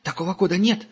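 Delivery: high, hurried scared denial; the angel lies to Gerda, high and frightened; panicky denial. A narrator's reading. Captive denies in panic.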